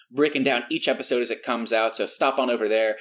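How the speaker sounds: ordinary talk, nothing marked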